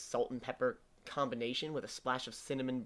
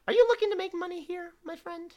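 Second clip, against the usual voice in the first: high, sly, quick questioning; sly little man voice; 8 dB louder than usual. Acted. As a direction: lean in like a sly hustler. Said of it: "he goes".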